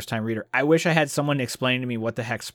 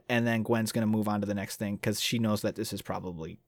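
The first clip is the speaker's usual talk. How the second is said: normal talking voice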